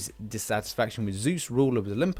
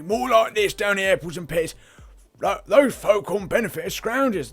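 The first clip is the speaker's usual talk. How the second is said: higher, louder Cockney voice; Cockney accent; 6 semitones higher, 4 dB louder than usual. Retold or imitated. Gamer mocks a Cockney saying the benefits line, hammed-up Cockney. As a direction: say it like a Londoner. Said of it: "No, people down south don't say that".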